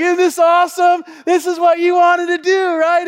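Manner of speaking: high, loud hammed-up cheering; overdone enthusiasm. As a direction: yell it over the coaster at a scared kid. Dad overdoing enthusiasm on coaster.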